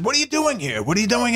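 Mel Brooks. Retold or imitated. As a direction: ask with showy interest. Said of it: "And then he says".

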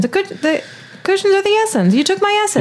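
high, whiny indignation; mock indignant. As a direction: whine it, offended. Continues the mock rant.

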